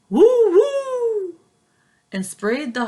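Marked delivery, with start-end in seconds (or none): 0.1-1.4 high loud woofing; barking dog. Acted. Daunted dog barking at the sneeze, high and loud. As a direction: bark it out like a startled dog. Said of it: "who barked".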